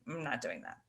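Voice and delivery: soft, slightly low refusal to oneself; reluctant inner thought. Retold or imitated. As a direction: imitate a private "no way" thought, quietly. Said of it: "I literally just remember thinking".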